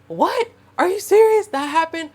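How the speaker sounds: high, loud mock outrage; shrill-free but sharp "are you serious" in mock anger; mad disbelief. Reenacting her talk with her ex, high loud mock outrage. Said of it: "like, mad".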